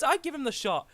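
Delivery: ordinary talk, nothing marked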